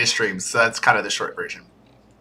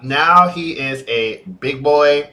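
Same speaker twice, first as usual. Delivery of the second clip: usual speaking voice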